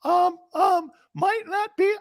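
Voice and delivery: timid falsetto radio voice, full of ums; very high, tentative caricature; high-pitched West Coast host. Shown as a demonstration. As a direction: squeak out hesitant questions as a mock West Coast host. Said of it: "I'm talking in that high-pitched voice".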